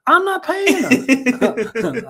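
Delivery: high, drawn-out mock refusal; playfully protest, stretching it out high. Mock protest at paying co-host.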